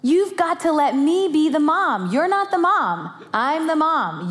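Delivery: exasperated mother scolding; fed-up mom. Retold or imitated. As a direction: lay down the law to the kids. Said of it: "Now you're getting there".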